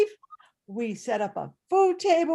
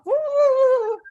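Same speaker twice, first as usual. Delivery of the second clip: high, loud excited whooping; excited whoops; 13 semitones higher, 8 dB louder than usual. Retold or imitated. Boy at the window spotting his dog, whooping. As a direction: whoop it out like a thrilled kid.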